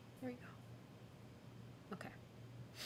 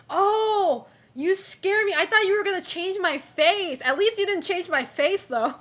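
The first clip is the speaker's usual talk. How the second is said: loud, higher, laughing relief; excited relief; 5 semitones higher, 5 dB louder than usual. Real, not acted. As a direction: blurt out relief at the fan art. Reacting to fan art.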